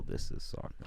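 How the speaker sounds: hushed, unvoiced dramatic line; whispered announcer. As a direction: breathe it like a trailer. Repeats joke line whispered.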